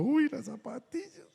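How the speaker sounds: high, bright burst of excitement, leaning in; a teen gasping over the shoebox he'd waited months for; eager delight. Retold or imitated. Teen retelling his thrill on getting the shoebox, eager. Teen sees the gift box.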